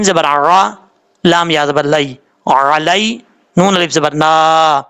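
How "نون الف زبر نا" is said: normal talking voice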